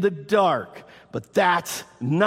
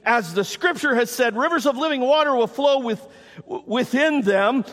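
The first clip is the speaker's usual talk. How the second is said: raised, high preaching voice; ringing public declaration, pitched up; loud proclamation; 8 semitones higher, 4 dB louder than usual. Acted. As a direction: call it out to a whole crowd. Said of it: "said in a loud voice".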